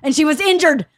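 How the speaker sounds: loud, high, rushed self-justification; frantic outraged tirade; retold screaming. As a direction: imitate an indignant dad ranting. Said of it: "Everybody here is screaming".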